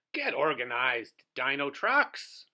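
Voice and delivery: rally the team firmly; commanding call. Acted. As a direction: boss truck barking orders. Tyrannosaurus roars orders.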